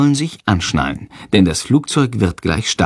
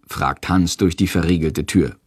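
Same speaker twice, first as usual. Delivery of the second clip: plain reading voice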